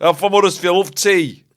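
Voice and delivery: put-on Huddersfield accent. Introducing yourself as a local, Huddersfield accent. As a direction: play the proud Huddersfield local. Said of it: "we're doing the English".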